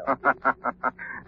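soft, distant mocking laugh; sinister laugh. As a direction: laugh like a phantom. Unseen Shadow laughs.

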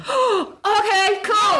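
high, slow, trying to sound casual; a thrilled voice that pretends to be calm; mock cool excitement. To the madam, hearing Cranky Bear is back: high, slow fake calm.

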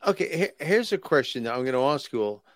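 ordinary talk

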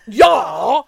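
shouted yeah rising at end; loud drawn-out yeah. Shown as a demonstration. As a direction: belt out "Yeah" with a rise. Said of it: "Like that. Not".

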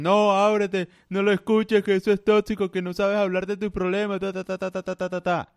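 high mocking whiny imitation of women. Mocking what women tell men, high parody voice. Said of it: "las mujeres dicen".

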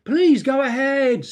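loud, high, exaggerated politeness; sarcastic apology. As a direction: overdo the apology, dripping sarcasm. Acted sarcastic apology repeated.

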